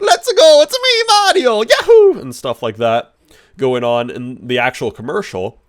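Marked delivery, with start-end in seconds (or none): 0.0-2.2 very high, loud cartoon Italian accent; Mario. Reviewer mimicking the plumbing commercial, shrill Italian accent. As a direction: belt out a squeaky Italian cartoon voice. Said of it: "had their Italian accents".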